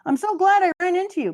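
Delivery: high, quick, loud cheery greeting; playful Luffy. Retold or imitated. Fan voicing hero greeting a friend, high and bright. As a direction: chirp it like a happy kid hero.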